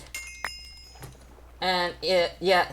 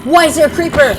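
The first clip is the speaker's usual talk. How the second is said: alarmed; 10 semitones higher, 12 dB louder than usual. Real, not acted. Sudden creeper appears.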